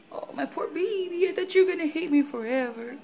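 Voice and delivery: high pitying coo to the pet. Real, not acted. Cooing to her dog.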